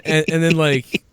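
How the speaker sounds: ordinary talk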